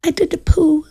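put-on high teary little-girl wail; crying child. Little girl in the bath confessing to auntie, mock crying. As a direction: imitate the child confessing through tears. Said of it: "She goes".

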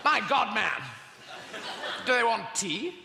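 hammed-up gentleman asking about tea; posh British officer. Acted Death Star officers.

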